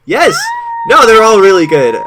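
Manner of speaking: high, loud emphatic protest; real excited reassurance; excited insistence. Real, not acted. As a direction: insist loudly through laughter. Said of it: "I'm dead".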